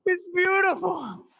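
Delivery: high, drawn-out cry of wonder; acted awe. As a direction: marvel at it in a high, strained voice. Acting out his awe on the skydive.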